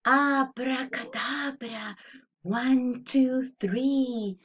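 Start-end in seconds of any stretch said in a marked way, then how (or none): none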